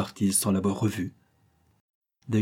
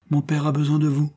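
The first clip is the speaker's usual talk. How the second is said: firm; 7 semitones higher, 4 dB louder than usual. Acted. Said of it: "dit-il avec fermeté".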